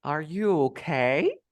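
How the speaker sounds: slow "Are you okay", long rise on okay; stress on last word. Shown as a demonstration. Teacher demonstrating word stress to student, drawn-out rising okay. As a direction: draw out "okay" upward into a question. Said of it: "última palabra".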